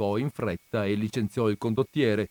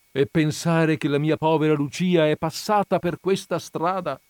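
heartfelt exclamation; exclaiming; 6 semitones higher, 5 dB louder than usual. A narrator's reading. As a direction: cry out over her daughter's path. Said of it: "esclamò". (esclamazione accorata)

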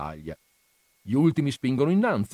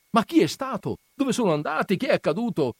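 crowd questioning; 10 semitones higher than usual. Acted. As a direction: press for answers. Crowd presses the priest.

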